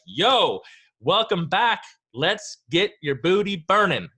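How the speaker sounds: loud, high put-on gym hype with drawn-out words; hyped HIIT instructor. HIIT coach greeting class over pumping music, loud hype. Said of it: "why I had that accent".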